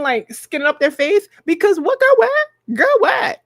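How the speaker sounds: high, fast, bewildered rant; mock bewilderment. Retelling her reaction to her therapist, high bewildered rant. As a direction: rattle it off high and fast, totally confused. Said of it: "And I was like".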